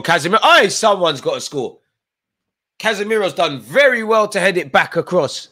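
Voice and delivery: loud and high, a big chance; real thrill at the near goal; excited shout. Real, not acted. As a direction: yell as the ball flies across. Goalmouth scramble, near chance.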